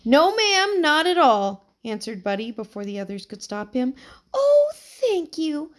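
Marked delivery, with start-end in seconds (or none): 0.0-1.7 put-on eager boy. 4.3-5.7 put-on high, slow, delighted old woman; beaming old lady. A narrator's reading. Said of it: "her face beaming".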